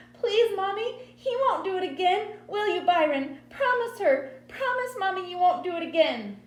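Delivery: put-on high, loud little-girl begging; desperate child's pleading. A narrator's reading. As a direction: beg frantically for your brother. Crying child begs mother for brother.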